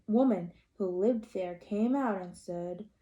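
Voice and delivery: ordinary delivery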